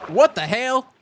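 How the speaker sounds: high, loud mock bewilderment; baffled family member. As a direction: act out a startled relative hearing a new accent. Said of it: "And they're like".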